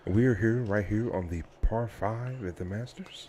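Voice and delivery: softly lightly voiced golf broadcaster; Jim Nantz impression. Co-host mimicking commentary at the Masters, hushed broadcaster voice. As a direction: hush it like live golf commentary. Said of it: "broadcaster Jim Nantz".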